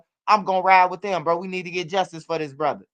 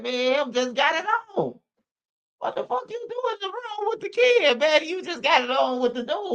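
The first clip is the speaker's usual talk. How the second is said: much higher mocking woman's voice; imitating the mom explaining herself, far higher; 12 semitones higher than usual. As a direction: squeak it up like the mother. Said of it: "The woman just said".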